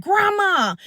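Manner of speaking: high-pitched, drawn-out cry out; retold crying scream. Crying to grandma at the altar, retold scream. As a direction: call out as if pleading, imitated. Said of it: "and I'm crying".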